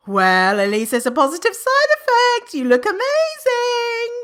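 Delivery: a sickly sweet backhanded compliment; fake bright praise. Mocking a friend's praise of her illness, sugary. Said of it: "Someone's gonna be like".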